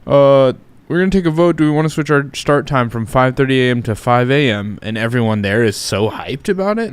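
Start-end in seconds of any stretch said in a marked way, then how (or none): 0.1-4.8 coworker voice. Retold or imitated. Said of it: "they were just like".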